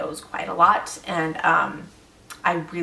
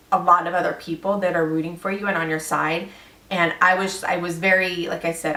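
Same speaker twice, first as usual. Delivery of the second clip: ordinary talk, nothing marked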